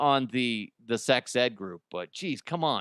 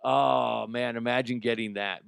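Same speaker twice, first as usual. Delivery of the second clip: usual speaking voice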